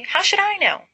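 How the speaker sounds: high exasperated retort; irritated. Acted. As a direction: throw the question back in annoyance. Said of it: "Narcissa says irritably".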